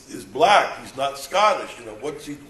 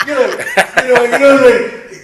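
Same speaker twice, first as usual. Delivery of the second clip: laughing; 9 semitones higher, 11 dB louder, faster than usual. Real, not acted. Laughing mid story.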